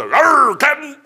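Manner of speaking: a hint of a hammed-up pirate to the captain; mock pirate sailor. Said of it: "I always just say".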